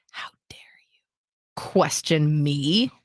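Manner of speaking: whispered fury, slowing to a deliberate finish; seething anger. Retold or imitated. Mom confronts her daughter with a death stare, seething. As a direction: imitate icy rage, whisper then stretch the words. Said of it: "now she's so pissed".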